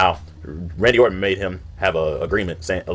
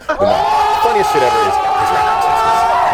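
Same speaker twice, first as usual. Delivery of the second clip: laughing hard, higher and louder; laughing shout; 5 dB louder, much slower than usual. Real, not acted. Host cracking up over the rap, laughing loudly.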